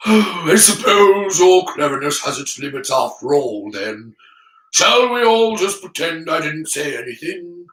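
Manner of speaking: put-on resigned old headmaster voice; put-on wizard's voice, letting it go with a sigh; sighing Dumbledore. Dumbledore giving up on explaining to Harry, sighing and resigned. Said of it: "and sighed".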